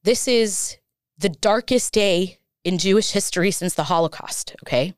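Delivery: real emotion rising into indignation; very emotional. Real, not acted. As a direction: let the outrage build as you testify. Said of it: "I'm gonna get very emotional right now".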